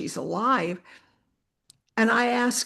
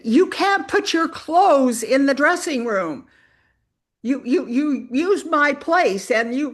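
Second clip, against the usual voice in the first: raised, drawn-out angry scolding; mock yelling; 5 semitones higher, 4 dB louder than usual. Upset woman yells at a newcomer over her dressing room spot, mock yelling. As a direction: imitate an upset woman yelling, louder and higher. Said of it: "screaming and yelling at me".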